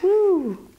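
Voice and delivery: high, bright cheer; excited woo. Acted. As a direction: whoop with delight. Cheers at open mouth.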